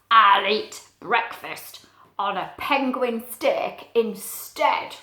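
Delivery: slow, loud growl of a hungry polar bear. A narrator's reading. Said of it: "Polar Bear shook her head and growled".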